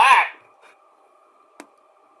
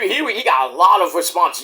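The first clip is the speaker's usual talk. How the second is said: ordinary talk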